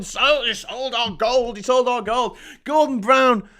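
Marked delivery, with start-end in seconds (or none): 0.0-2.4 drawn-out parody of a repeated complaint; slow, droning mock grievance; mocking Tory harping. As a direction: mimic them going on and on, slowly. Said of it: "Tories used to go on and on and on".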